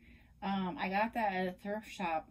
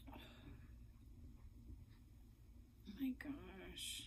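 whisper; 3 semitones higher, 15 dB quieter than usual. Real, not acted. Overwhelmed whisper at gifts.

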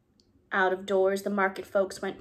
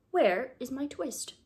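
slow question; 4 semitones higher, slower than usual. Acted. Tailor asks Simpkin.

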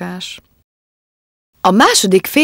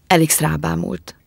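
ordinary delivery

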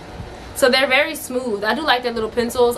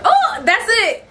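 excited squeal; 11 semitones higher, 4 dB louder, slower than usual. Real, not acted. Excited about the jeans.

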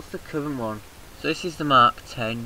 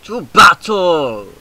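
slow, loud hammed-up rallying cry; theatrical war cry; mock battle cry; 4 semitones higher, 11 dB louder, much slower than usual. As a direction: charge them into battle like a general.

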